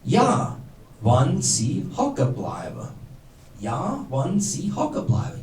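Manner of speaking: Ja, wann sie hocken bleiben said slowly and softly, word by word; slow teaching delivery of Ja, wann sie hocken bleiben; dialect demonstration. Shown as a demonstration. Giving the dialect answer to the audience, slow demonstration of Ja, wann sie hocken bleiben. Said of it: "they know the answer to the question".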